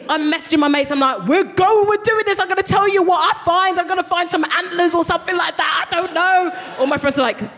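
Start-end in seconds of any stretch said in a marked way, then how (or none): 1.3-6.5 high, eager, giddy rush; pitched-up giddy excitement; excited. Retold or imitated. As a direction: sound thrilled about the adventure. Excited texts to mates.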